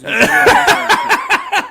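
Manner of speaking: words squeezed through high laughter; real laughter over the memory; laughing. Real, not acted. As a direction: crack up mid-sentence. Laughing while telling story.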